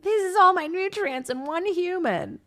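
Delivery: high-pitched, hammed-up complaint; mock exasperated new mother. Drained mother presenting her tiny newborn, mock exasperation.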